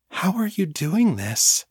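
high, hushed-style child's wonder, still voiced; awed. A narrator's reading. Girl watches the tree mend itself, awed. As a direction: ask in mild awe. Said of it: "Fiona whispered in awe".

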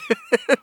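quick high burst of laughter; short laugh. Real, not acted. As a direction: let a laugh escape.

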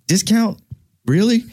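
high, disbelieving repeat of a word; incredulous. Retold or imitated. Asking his grandmother outright, high and incredulous. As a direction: throw the word back at her in disbelief. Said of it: "I was like".

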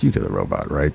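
low, soft mock voice; woman auditioner. Auditioner asking the guy about the robot, low and soft. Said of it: "she came over and she's like".

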